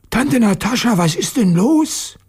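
high, wondering schoolboy voice; puzzled boy. Acted. Petja asks Aunt Natascha what is wrong, high puzzled boy voice. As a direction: ask like a confused child. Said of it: "Petja fragte verwundert".

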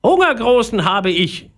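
high, demanding parrot. A narrator's reading. Hungry parrot pesters Philipp, high demanding voice. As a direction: announce it in a squawky bird voice. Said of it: "ihn der Papagei mit dem Schnabel am Pulli zupfte".

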